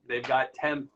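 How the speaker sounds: ordinary talk, nothing marked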